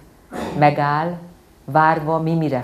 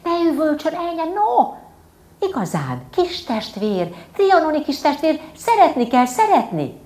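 high, hushing auntie voice; hushing old aunt; 13 semitones higher, 4 dB louder than usual. Acted. Ilka néni hushing the screaming girl, high and coaxing. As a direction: coo at the child to calm her down. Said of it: "csittított".

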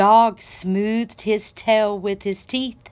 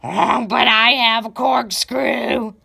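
piggy grunting boast; 2 semitones higher, 3 dB louder than usual. A narrator's reading. Pig bragging about his curly tail, grunting. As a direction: grunt it out proudly. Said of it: "grunted Pig".

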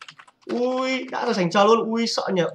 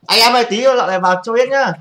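calling out loud; 4 semitones higher, 8 dB louder than usual. Real, not acted. Rallying viewers to spam.

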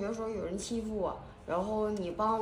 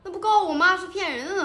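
indignant outburst; 9 semitones higher, 7 dB louder, faster than usual. Acted. Indignant objection in rehearsal.

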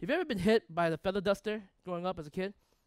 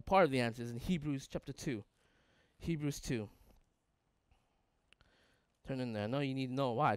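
ordinary talk, nothing marked

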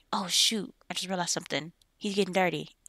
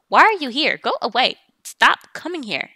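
higher and louder, shooing them off; annoyed shooing; 5 semitones higher, 7 dB louder than usual. Acted. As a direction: chase the visitor away with a raised, fed-up voice. Shooing an unwanted visitor away.

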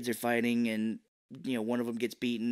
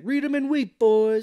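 high, loud imitated kid crowing; hammed-up triumphant high voice; gloating poker kid; 11 semitones higher, 9 dB louder than usual. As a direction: crow it out like a bragging kid.

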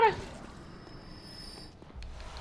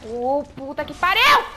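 raging gamer; 6 semitones higher, 8 dB louder, slower than usual. Real, not acted. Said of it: "raging at the game".

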